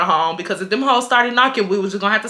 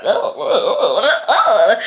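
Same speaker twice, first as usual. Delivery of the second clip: happy squeal; 3 dB louder, slower than usual. Retold or imitated. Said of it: "I was happy that".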